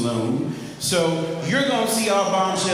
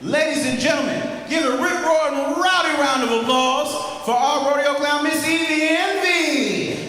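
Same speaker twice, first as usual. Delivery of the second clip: announcer intro; 10 semitones higher than usual. Acted. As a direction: build it up like a ringmaster. Introduces next performer.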